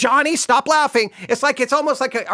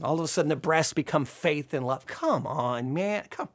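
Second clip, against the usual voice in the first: much softer and lower, fed up; exasperated mutter; 6 semitones lower, 9 dB quieter than usual. Real, not acted. Preacher grumbling to his class about allegory, soft disgusted mutter. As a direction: mutter it under your breath in disbelief.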